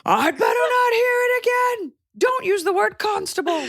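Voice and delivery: hammed-up outrage, half laughing; mock scolding. Host forbidding the word constable, high mock scolding. Playful scolding over fake British accents.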